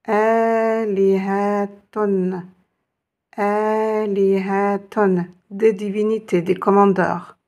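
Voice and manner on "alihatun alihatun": Alihatun lu lentement, syllabe par syllabe; détachez bien Alihatun, lentement; lent et appuyé. Shown as a demonstration. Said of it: "voici son pluriel". (prononcez Alihatun lentement, syllabe par syllabe)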